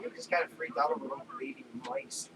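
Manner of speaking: fast sheepish whisper. Retold or imitated. Workers admitting to the boss, a fast sheepish whisper. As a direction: mumble it out embarrassed and quick. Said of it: "We're like".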